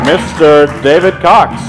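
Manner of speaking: normal talking voice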